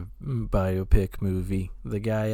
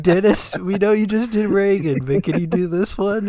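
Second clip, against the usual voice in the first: higher, louder mock pitch to an actor; pitching studio executives; 8 semitones higher, 4 dB louder than usual. Studio people asking Dennis to take another role, mock pleading. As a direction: coax him in a raised, playful voice.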